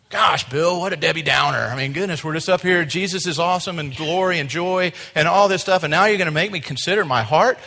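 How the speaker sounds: high, loud, exasperated objection; mock complaint. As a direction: protest loudly like a put-upon churchgoer. Voicing a congregant's objection.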